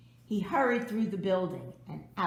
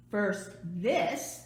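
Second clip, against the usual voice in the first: ordinary delivery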